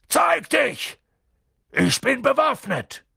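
loud, high threatening call; shouting. A narrator's reading. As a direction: shout a warning at an unseen intruder. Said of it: "rief Hagrid". (laut und drohend gerufen)

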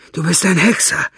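high, loud fearful blurt. Acted. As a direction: spit it out in fear. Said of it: "stieß er hervor".

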